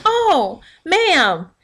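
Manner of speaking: mock the salesperson's phony sweetness; fake friendly clerk. Mocking store clerks to viewers, high fake-sweet voice. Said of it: "trying so hard to be fake about being friendly".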